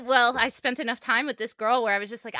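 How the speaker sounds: ordinary talk, nothing marked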